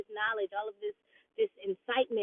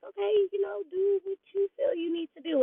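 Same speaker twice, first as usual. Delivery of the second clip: higher, lightly put-on friend voice giving advice; imitating a friend; 9 semitones higher than usual. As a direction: play the shrugging friend, pitched up.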